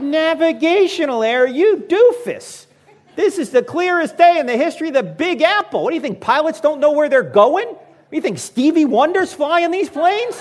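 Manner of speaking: high, loud, scornful laughing rant; ranting back at the TV commentator, high, loud and scornful; mocking rant.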